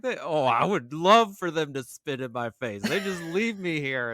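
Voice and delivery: high, loud imitation of a jealous prisoner; hammed-up prisoner. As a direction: play up the neglected prisoner's longing. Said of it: "And he's like".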